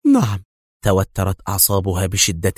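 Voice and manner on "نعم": firm, curt. A narrator's reading. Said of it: "في حزم واقتضاب".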